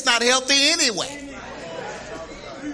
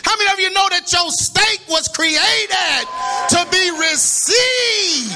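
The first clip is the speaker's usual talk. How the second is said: preaching shout; 9 semitones higher than usual. Real, not acted. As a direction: shout it to the church, leaning into received.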